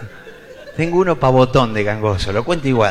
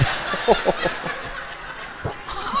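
breathy laugh; 9 semitones higher, 8 dB quieter than usual. Real, not acted. Cracks up at own bit.

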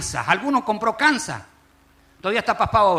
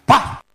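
sudden loud "paf" impact noise; imitated crash; 5 semitones higher, 7 dB louder than usual. Comic telling a fall in the kitchen, imitated loud smack. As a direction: burst it out like a body hitting the floor.

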